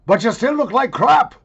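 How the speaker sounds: loud, high comic punchline; hammed-up shouted insult; mock insult yell. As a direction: holler the put-down for laughs. Comic punchline insult after flattery.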